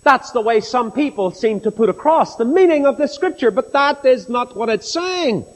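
ordinary delivery

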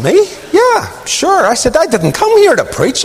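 high, disbelieving yelp of surprise; incredulous protest. Retold or imitated. Asked to preach, protesting in high disbelief. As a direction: squeak it out in disbelief.

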